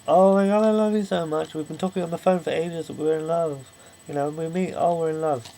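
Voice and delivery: high, gushing lovestruck declaration, hammed up; gushing mock lovers. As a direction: gush like a smitten couple, mockingly.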